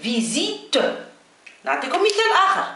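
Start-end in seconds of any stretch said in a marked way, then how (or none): 0.0-0.8 French "visite" as "vi-zit", buzzing Z in the middle; Z pronunciation. Shown as a demonstration. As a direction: say "فيزيت" with a voiced Z between the vowels. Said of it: "هنا ينطق ز".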